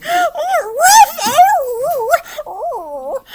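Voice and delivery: high, loud panicked yelps; dogs screaming. Acted. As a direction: shriek like terrified dogs. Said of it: "and the dogs screamed".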